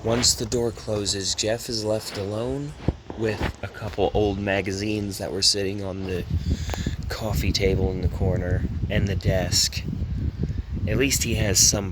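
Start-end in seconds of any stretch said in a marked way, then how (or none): none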